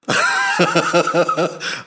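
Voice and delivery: loud burst of laughter at his own joke; hearty laugh. Real, not acted. Teasing men about their useless dishwashing, loud laughter.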